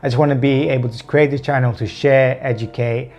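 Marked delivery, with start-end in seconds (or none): none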